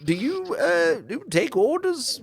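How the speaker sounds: merchant npc. Acted. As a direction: squeak out the request. NPC asks the Earl.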